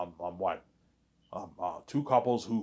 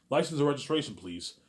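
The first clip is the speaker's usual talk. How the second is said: lightly voiced cop, raised opening; 6 semitones higher, 4 dB louder than usual. Cop to the driver he stopped for speeding, lightly acted cop. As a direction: give a hint of a traffic cop making a demand. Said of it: "by, by a cop".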